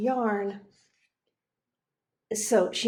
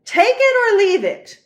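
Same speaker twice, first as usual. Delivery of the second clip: loud, high demanding shout; angry ultimatum; 12 semitones higher, 12 dB louder than usual. Acted. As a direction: slam down the final offer. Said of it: "shouted the archduke".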